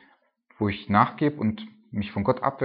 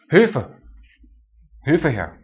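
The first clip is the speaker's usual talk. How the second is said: shouted high and loud, calling for help; acted cry for help; 4 semitones higher, 7 dB louder, much slower than usual. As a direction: cry out Hilfe like a desperate inner voice. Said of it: "und es schreit und es ruft".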